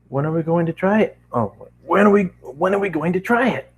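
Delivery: high, impatient pony voice; Rainbow Dash eager. A narrator's reading. Rainbow Dash pressing to try the portal, high and impatient. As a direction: push for an answer now. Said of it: "Rainbow Dash asked".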